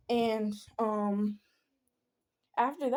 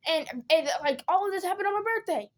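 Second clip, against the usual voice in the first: retold upset complaint; 7 semitones higher than usual. Retelling her upset complaint to mom.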